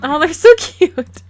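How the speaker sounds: swooning over the cute Sims; gushing. Real, not acted. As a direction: gush over how cute they are.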